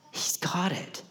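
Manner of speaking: hushed confiding whisper. Acted. Preacher assuring the church in a whisper. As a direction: breathe it like a secret.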